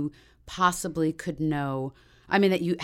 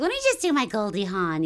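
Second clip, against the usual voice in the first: Goldie Hawn voice; 4 semitones higher, 3 dB louder than usual. Retold or imitated. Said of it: "So I was like".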